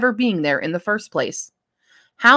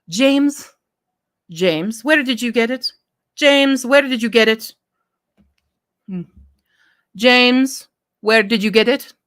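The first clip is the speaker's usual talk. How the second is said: higher voiced Taliba with a light Middle Eastern accent; 5 semitones higher than usual. Taliba calling to James about the car, Middle Eastern accent. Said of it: "Her Middle Eastern accent wasn't thick".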